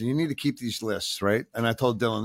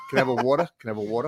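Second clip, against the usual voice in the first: put-on New York accent; much faster than usual. Teaching [name] how to talk in New York, hammed-up New York accent. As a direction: ham up a Noo Yawk order at a counter. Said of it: "let me hear your New York accent".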